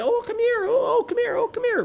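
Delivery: mocking the vet's coaxing voice; high coaxing dog voice. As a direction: coax the dog like baby talk. Vet coaxing dog with treat.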